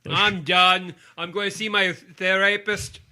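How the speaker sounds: loud mock-outraged actor quitting in a put-on voice; Sean Connery. Co-host voices the actor quitting over a bad movie, Sean Connery impression. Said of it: "He's like".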